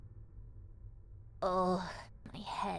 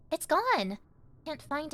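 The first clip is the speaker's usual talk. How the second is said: alarmed realization; 6 semitones higher, slower than usual. Acted. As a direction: realize it is really lost, with a jolt.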